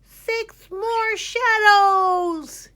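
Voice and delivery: very high, very loud, very slow shout; sleepwalking boy shouting. A narrator's reading. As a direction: bellow it to the world. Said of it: "he shouted".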